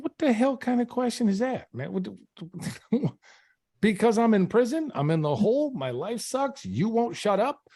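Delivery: high-pitched exasperated rant; angry retort. Retold or imitated. Prisoner answering why he is so angry, exasperated retort.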